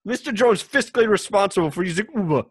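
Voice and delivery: Indiana Jones taxi driver. Acted. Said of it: "the next taxi driver for Indiana Jones".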